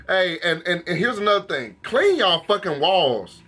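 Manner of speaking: normal talking voice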